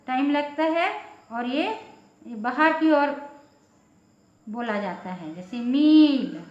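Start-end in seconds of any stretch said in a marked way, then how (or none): 5.6-6.4 मील loud and drawn out on released breath; long ee. Shown as a demonstration. Teacher showing outward breath, long loud vowel. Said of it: "सांस को बाहर छोड़ते हुए".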